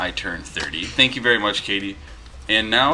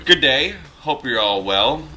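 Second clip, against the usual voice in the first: lightly attempted Australian accent, higher opening; hint of Australian accent; 4 semitones higher, 4 dB louder than usual. Reading an Australian viewer's letter aloud, slight Australian accent. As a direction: begin as if going into an Australian accent. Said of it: "I almost started with an accent".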